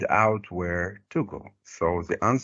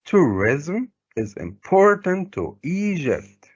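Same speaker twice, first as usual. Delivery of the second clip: higher-pitched model reading, IMPORTANT stressed on its middle syllable; marked word stress; 7 semitones higher than usual. Shown as a demonstration. Teacher showing how the stress will be, marked word stress. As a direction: hit TOURISM, IMPORTANT and EGYPT with high, swooping stresses. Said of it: "the stress will be like this".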